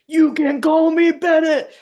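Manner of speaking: high, loud holler; shouted. Acted. Player imagines Bennett yelling his name at Johnson, shouted. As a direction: shout it out at full pitch, stretching it. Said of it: "like, shouted like a".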